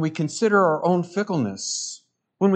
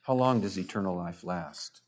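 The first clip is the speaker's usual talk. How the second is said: "How long does eternal life last?" asked again much lower and softer; quiet rhetorical repeat; 8 semitones lower, 8 dB quieter than usual. Shown as a demonstration. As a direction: drop the voice and let it sink in. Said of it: "Need I say more?".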